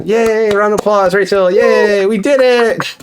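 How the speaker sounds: high-pitched celebratory yay; cheering. Real, not acted. Host cheers Rachel with a round of applause, cheering.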